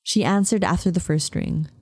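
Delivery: usual speaking voice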